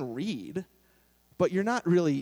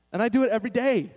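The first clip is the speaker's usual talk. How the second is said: higher, louder, quick punchy exclamation; exasperated; 6 semitones higher, 5 dB louder, faster than usual. Real, not acted. Exasperated confession, audience laughing.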